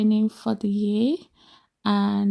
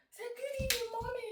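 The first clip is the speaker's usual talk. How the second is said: much higher, soft coaxing voice; 14 semitones higher, 13 dB quieter, faster than usual. Real, not acted. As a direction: gently prompt the little one to greet. Coaxing child to greet.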